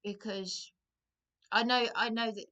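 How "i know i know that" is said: ordinary talk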